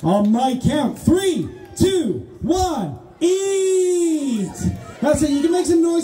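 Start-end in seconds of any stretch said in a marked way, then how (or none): 1.0-4.5 shouted countdown. Real, not acted. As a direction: call the start like a race starter. Host starts chili-eating countdown.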